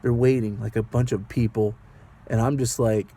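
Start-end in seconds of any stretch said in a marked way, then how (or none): none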